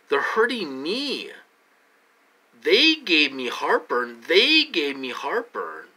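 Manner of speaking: pleading to be believed, voice climbing; distressed, rising voice. Real, not acted. Distressed recounting.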